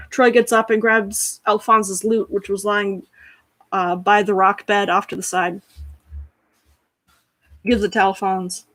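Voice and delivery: normal talking voice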